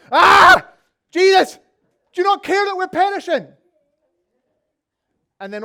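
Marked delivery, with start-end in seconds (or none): 0.1-3.5 much higher, much louder terrified cry; hammed-up shriek of fear; panicked yell. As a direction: scream at him to wake up in the storm. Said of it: "you're kinda going".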